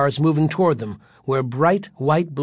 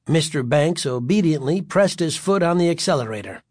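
usual speaking voice

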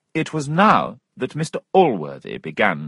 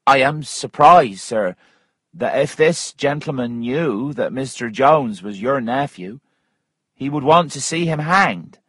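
higher-pitched, pointed character voice; a woman pressing her accusation, pitched up; accusing Missus Waters; 6 semitones higher than usual. Acted. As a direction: press the charge in a raised voice.

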